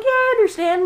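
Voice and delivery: bright, high sing-song service voice; customer service voice. Retold or imitated. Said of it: "put on my customer service voice".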